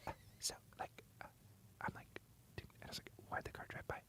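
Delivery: barely audible whisper; faint, hushed ASMR question. Pretend. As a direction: whisper very softly into the mic. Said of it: "Can you do some A S M R".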